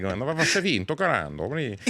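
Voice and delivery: Italian man exclaiming; Italian accent. Retold or imitated. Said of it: "I was just like".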